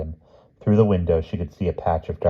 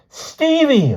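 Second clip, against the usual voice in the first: high, piercing scream of a name; loud, very high cry; 7 dB louder than usual. A narrator's reading. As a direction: scream out to stop them taking him. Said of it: "Lynn screamed".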